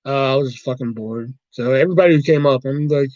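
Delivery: ordinary talk, nothing marked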